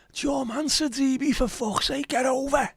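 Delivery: high-pitched Scouse terrace yell; Scouse football shout, pitched right up in exasperation; rabid frustrated Evertonian. Acted. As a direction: yell like a rabid fan from the stands. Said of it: "things do sound better in rabid, frustrated Evertonian".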